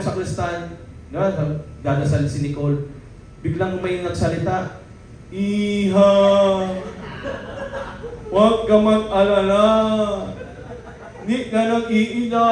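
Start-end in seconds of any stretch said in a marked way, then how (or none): 5.3-12.5 lightly nasal, slow comforting voice; mock nasal divine voice, slow and gentle; nasal voice from behind the altar. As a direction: pretend to be God but with a blocked nose. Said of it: "ngongo din".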